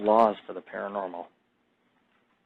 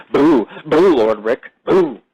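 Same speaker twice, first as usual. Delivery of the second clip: mock ghost; 4 dB louder, much slower than usual. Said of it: "ghosts fucking whispering in my ear".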